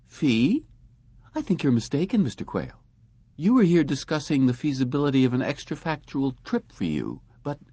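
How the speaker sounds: put-on high, composed young woman; smooth receptionist brush-off. A narrator's reading. Receptionist voiced.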